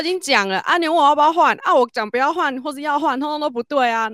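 high, loud incredulous protest; exasperated protest. Retold or imitated. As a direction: push back in disbelief at the coworker. Retold exasperated reply to coworker.

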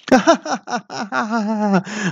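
high, hammed-up gang cackle; villains laughing. A narrator's reading. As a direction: laugh like mocking bandits. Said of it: "雪山四怪同时大笑".

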